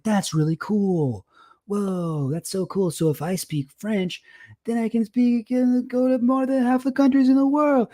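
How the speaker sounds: mock childlike wonder, rising high at the end; a hammed-up voice of an impressed kid; wide-eyed kid. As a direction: playfully imitate a younger self gushing over a poster. Kid self amazed by poster.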